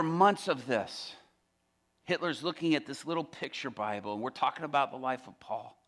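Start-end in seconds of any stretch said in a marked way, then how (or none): none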